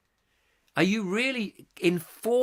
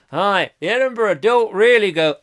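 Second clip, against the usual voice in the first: normal talking voice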